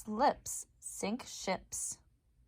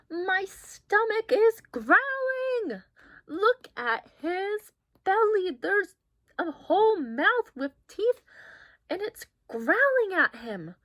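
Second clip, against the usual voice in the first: excited exclaiming; 7 semitones higher, 6 dB louder than usual. Acted. Excited reaction to picture.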